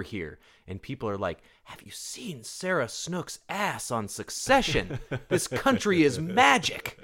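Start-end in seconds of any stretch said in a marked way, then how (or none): 1.6-7.0 slow, high-pitched gushing, building louder; mock awe. Americans raving about an Australian actress, mock awe. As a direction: rave it out slowly in hammed-up amazement.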